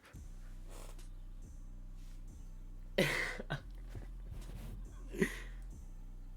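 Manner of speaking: disbelieving laugh. Real, not acted. Laughs at unfair suspension.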